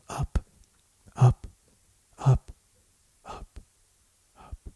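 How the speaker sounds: steadily fading to almost nothing; spaced-out echo dying away; fading repetition. Acted. Repeated word trails away in meditation.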